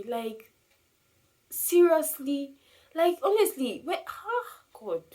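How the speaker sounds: high, loud, drawn-out incredulity; exasperated disbelief. Real, not acted. Griever reacting to a tactless remark, exasperated. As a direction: pitch leaps up in appalled disbelief, ending on a sigh.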